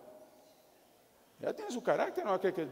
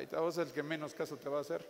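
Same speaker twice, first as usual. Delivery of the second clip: ordinary talk, nothing marked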